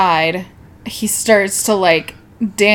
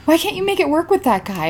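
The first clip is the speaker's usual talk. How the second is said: higher pleading voice, quick; mock nagging friend; 7 semitones higher, 4 dB quieter, faster than usual. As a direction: imitate a friend pushing you to try. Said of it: "you're always like".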